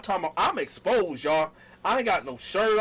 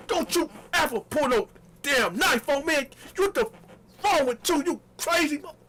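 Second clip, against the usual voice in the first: furious yell; 9 semitones higher than usual. Retold or imitated. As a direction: tear into her at full volume. Retold furious confrontation.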